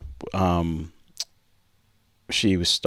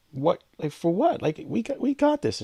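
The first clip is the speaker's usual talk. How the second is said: incredulous; 11 semitones higher than usual. Retold or imitated. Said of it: "And I'm like".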